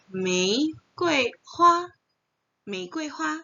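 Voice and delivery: Mei Gui Hua drawn out slowly, high and careful; slow tonal demo. Shown as a demonstration. Teacher models Mandarin word for students, slow and high.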